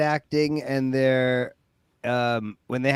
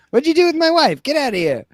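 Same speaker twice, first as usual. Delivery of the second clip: higher, louder mock outrage; mock angry shooing; 9 semitones higher, 5 dB louder than usual. Husband shooing a stranger from his house, mock outraged. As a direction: shoo the intruder out, hammed up.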